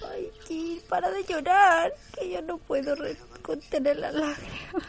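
high, loud fake sobbing; hammed-up tears, voice cracking; mock crying. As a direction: blubber through the words. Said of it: "me pasaría todo el capítulo haciendo esto".

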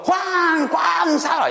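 high calling out by name, half-joking; mock call for help. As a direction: call the lifeguard by name from the water. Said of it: "Pero si digo". (llamada de auxilio fingida y aguda)

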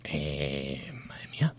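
breathless whisper; barely voiced shocked whisper. Real, not acted. Shocked reaction to sex question.

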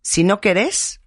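"querés" stressed on the closing "és"; accent on final E. Retold or imitated. Host finishing mom's saying, "querés" stressed on the E. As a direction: land the accent of "querés" on its last E. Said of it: "con acento en la E".

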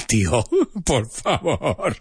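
slow, drawn-out plea with a laugh; acted, amused "oh please"; mock exasperated retort. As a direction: stretch it out in laughing disbelief. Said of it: "And then I'm like".

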